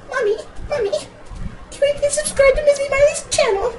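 put-on very high squeaky child begging; pleading little-kid voice. Kid begging Mommy to subscribe, in a squeaky high voice. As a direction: whine it up in falsetto to Mommy. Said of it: "And here I'm saying".

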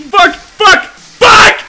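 high, very loud bursts; frustrated screaming. Real, not acted. Player finding no way out, frustrated scream. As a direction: scream each curse separately. Stuck in cave, cursing.

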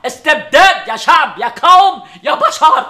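teasing mock rally cry to the people; mock outrage. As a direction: parody the kid's cry like a street orator. Father mocks child's protest words.

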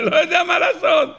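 mock Eliphaz's helplessness with a laugh; helpless Eliphaz. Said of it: "אומר לו אליפז".

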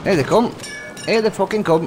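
ordinary delivery

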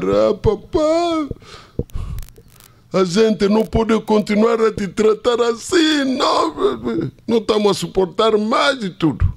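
high, pleading cry of a son to his father; crying plea. Retold or imitated. Son crying to his father, pleading high and strained. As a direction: beg papá through tears, high and strained.

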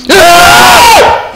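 very high, loud jump-scare scream; real shriek of fright; terrified scream. Real, not acted. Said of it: "What are you guys screaming?".

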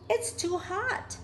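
high and slow little bug; high ladybug voice. Acted. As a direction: sigh it in a tiny high voice. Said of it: "said the lazy ladybug".

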